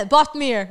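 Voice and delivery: "Bothmere" loud and high, badly pronounced; mock botched "Bothmere", announced loudly; imitating bad pronunciation. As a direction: proudly overdo a mangled "Bothmere". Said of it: "his pronunciation was, like, so bad".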